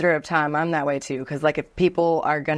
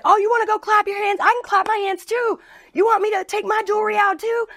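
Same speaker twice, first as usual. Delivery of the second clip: mock furious coworker. Redhead bartender squaring up to the rude customer, high heated challenge. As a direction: throw the threat right back at her. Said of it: "was not happy about this".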